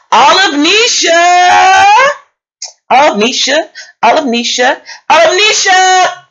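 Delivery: calling out a name at full volume; yelling full name. Real, not acted. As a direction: bellow the name across the house. Calling cousin from kitchen.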